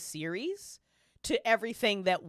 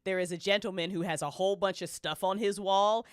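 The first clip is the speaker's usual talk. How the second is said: ordinary delivery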